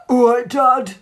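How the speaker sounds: put-on sulky teen girl voice; loud, grudging teenage daughter; moody teenager. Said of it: "is she gonna be like".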